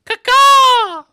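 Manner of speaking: very high, loud caw; piercing mock bird call; eagle screech. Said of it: "the eagle will just".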